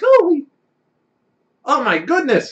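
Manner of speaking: high, loud astonished cry; amazed gasp. Acted. GM voices amazed onlooker.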